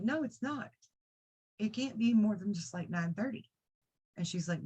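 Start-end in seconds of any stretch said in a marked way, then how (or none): 0.0-3.5 disbelieving protest. Retold or imitated. Daughter refusing to believe the time.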